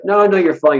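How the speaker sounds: mock brush-off; dismissive doctor. Retold doctor brushing him off.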